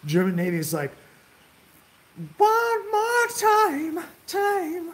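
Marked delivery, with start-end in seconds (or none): 2.4-4.9 very high, slow, stretched-out call; mock rallying cry. Admiral rallying the German fleet for one last attack, hammed-up high drawn-out cry. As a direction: sing it out like a hammy admiral rallying his men.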